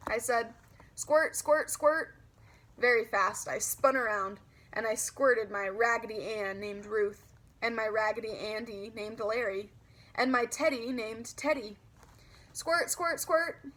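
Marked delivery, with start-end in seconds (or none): 0.9-2.1 higher playful squirt noises; mock squirt sounds. Girl playing clown in the mirror, playful squirt sounds. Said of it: "pretended to squirt myself". 12.5-13.6 higher playful squirt noises; spraying her dolls with pretend seltzer; mock squirt sounds. Girl squirting her toys, playful squirt noises. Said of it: "I said again".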